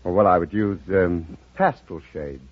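usual speaking voice

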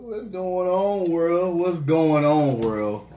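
slow, high, drawn-out shout-out; big lazy sing-out hello; hollered greeting. Real, not acted. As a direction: call it out to everyone, stretched. Calling out a show greeting.

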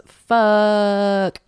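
a drawn-out loud curse; reading out her shocked notes, loud; mock exclamation. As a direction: read your note like a gasp of shock. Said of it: "I wrote in my notes".

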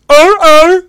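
high, loud barking walrus call; walrus noise. Retold or imitated. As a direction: bark it out like a walrus. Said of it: "everybody makes the walrus noise".

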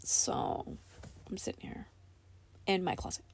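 hushed whisper, hiding in a closet. Real, not acted. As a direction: keep it hushed, crammed in a tight space. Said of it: "I'm sitting in the closet recording this".

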